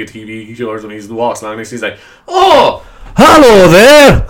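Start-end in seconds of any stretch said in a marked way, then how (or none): 2.3-4.2 much higher, much louder, slow hammed-up Shrek greeting; Shrek impression. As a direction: belt out a slow cartoonish Shrek "hello there" with a laugh. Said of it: "I'm assaulting your ears".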